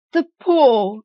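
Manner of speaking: sudden high, loud wild cry; wife waking her husband with a wild cry. A narrator's reading. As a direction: cry it out wildly, jolted awake by the thought. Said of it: "she cried wildly".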